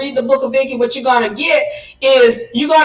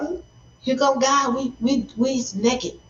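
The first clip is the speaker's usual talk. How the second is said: much softer, scared hush; hushed panic; 7 dB quieter than usual. Retold or imitated. Adam and Eve hiding from God, in a scared hush. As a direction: hide and murmur so God won't hear. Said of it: "they was like".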